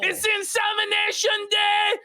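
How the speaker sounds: high mock battle cry; imitated lunatic screaming. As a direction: shout it like a crazed announcement. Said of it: "screaming about how he's gonna inseminate women".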